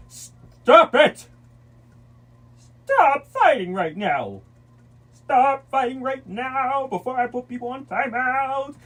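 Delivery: high loud slow shout; yelling stop. Real, not acted. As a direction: yell it to stop a fight. Yelling at fighting kids.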